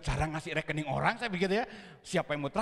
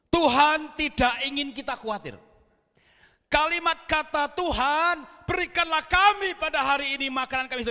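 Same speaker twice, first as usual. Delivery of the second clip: loud, raised pulpit delivery; forceful preaching; 8 semitones higher, 7 dB louder than usual. Real, not acted. Preacher urging the congregation not to worry, emphatic preaching. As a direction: proclaim it to the whole congregation.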